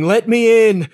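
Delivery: high, loud pleading shout at the gate; loud demand. Retold or imitated. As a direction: pound on heaven's gate and demand entry.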